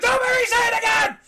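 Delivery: fast, high, loud yell; frantic high-pitched guard holler; guard shouting. Acted. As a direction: rattle it out at the top of your voice. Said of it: "he goes".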